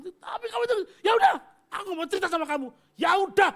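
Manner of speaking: high, loud mock shouting match; shouting in a quarrel. Pastor reenacts shouting at his wife, loud and high. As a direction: yell it like a fed-up spouse mid-fight.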